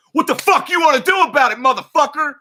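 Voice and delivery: high, loud mock tough-guy yell; squaring up, shouting him down; retold challenge to fight. Getting out of the car to fight, mock shouting. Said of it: "I get out the car like".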